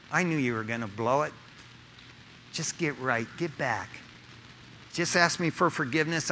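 low, soft, easygoing reassurance; calm a friend who blew it, voice kept down; gentle forgiveness. Acted. Preacher voices Jesus telling Pete to get back, gentle reassuring tone.

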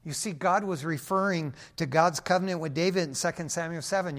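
ordinary talk

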